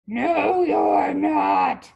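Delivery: loud, rough troll shout; troll's roar. A narrator's reading. Troll refuses the little goat passage, roaring. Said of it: "roared the troll".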